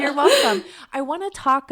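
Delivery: laughing. Real, not acted. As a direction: laugh it out warmly. Laughing thanks exchange.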